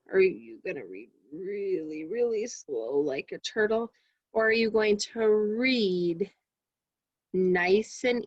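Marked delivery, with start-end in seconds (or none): 0.1-3.9 crawl through it slowly; slow like a turtle. Shown as a demonstration. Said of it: "how fast you read".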